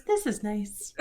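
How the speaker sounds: soft hushed line; a quiet, content whisper; softly murmured during a hug. Acted.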